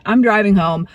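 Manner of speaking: usual speaking voice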